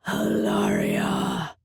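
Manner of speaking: roaring Minotaur. A narrator's reading. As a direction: roar the name out like a furious beast. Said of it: "he roared".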